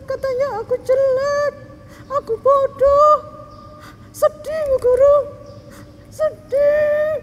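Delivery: put-on high childlike voice, hurt and whimpering; sad little girl voice. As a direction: play a sad little girl telling the teacher she was teased.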